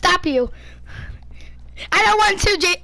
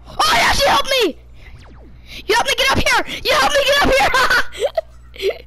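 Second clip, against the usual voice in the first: excited cheer; 7 semitones higher than usual. Real, not acted. Kid helped up a ledge, excited cheering. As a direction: cheer your teammate on. Celebrating teammate's help.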